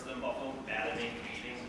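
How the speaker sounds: ordinary talk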